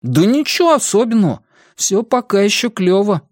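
put-on high, casual teen voice; Бенни. A narrator's reading. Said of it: "он задумался". (высокий мальчишеский голос, отмахивается)

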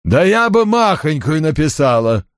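unsmiling secretary. A narrator's reading. Secretary answers boss's joke about saving a tree, unsmiling. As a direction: answer the joke in a put-on voice, unamused. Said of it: "без улыбки ответила Пат".

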